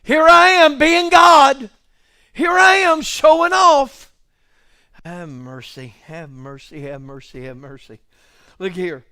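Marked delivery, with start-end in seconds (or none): 0.0-4.1 mock-grand voice of God showing off. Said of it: "I almost won't go". 5.0-8.0 much lower and softer murmur; hushed awed murmur after the climax; hushed have mercy. Acted. Preacher's hushed exclamation after peak.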